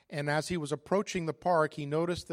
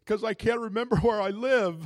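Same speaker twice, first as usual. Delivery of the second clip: loud, high comic wail; hammed-up tearful outburst, played for laughs; mock bawling; 7 semitones higher, 9 dB louder than usual. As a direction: wail the punchline like a weeping old man. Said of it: "He was just bawling his eyes out".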